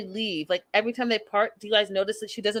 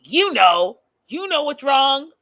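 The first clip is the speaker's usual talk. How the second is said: sulky retort; 4 semitones higher, 6 dB louder than usual. Retold or imitated. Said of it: "he's like".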